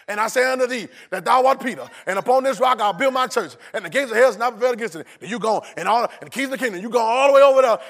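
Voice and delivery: high, loud, barrelling recitation; rushed reading. Shown as a demonstration. Preacher showing how readers miss the tone, loud rushed recitation. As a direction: race on through verse after verse. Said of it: "and we don't slow down".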